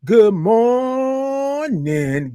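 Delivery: long sung-out "good morning" drawn high and slow; drawn-out morning show greeting; stretched good morning. Shown as a demonstration. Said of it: "I love all those O's and R's and I N G's".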